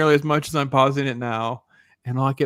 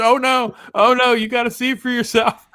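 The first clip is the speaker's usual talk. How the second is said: higher, teasing oh no; imitating Loki; 9 semitones higher, 3 dB louder than usual. Loki teasing the speaker on the phone, amused. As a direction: tease like a friend who knows. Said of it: "He goes".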